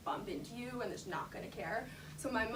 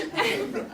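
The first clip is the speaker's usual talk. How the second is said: breathy laugh; 10 semitones higher, 10 dB louder than usual. Real, not acted. Laughs after the quote.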